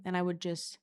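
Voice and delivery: ordinary talk, nothing marked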